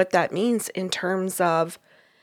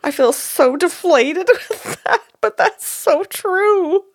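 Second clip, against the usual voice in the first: laughing deflated; 9 semitones higher, 5 dB louder than usual. Real, not acted. Admits feeling deflated, laughing.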